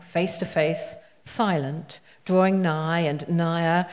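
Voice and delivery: slow, low, measured recital; poetry reading. Acted. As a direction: let each image hang in the air. Reads sonnet twenty-two aloud.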